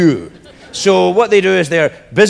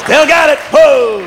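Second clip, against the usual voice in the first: high, loud boast to the crowd; triumphant crowing; 8 semitones higher, 4 dB louder than usual. Real, not acted. Comic tells the audience he's still got it, crowing loudly. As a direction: crow it out with a whoop.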